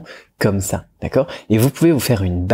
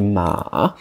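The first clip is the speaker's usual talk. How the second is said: "ma" dipping low, then rising; "ma" as a long Mandarin third tone, down then up; 3 semitones lower, 3 dB quieter than usual. Shown as a demonstration. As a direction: sink the pitch on "ma" and bring it back up. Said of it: "avec le troisième ton".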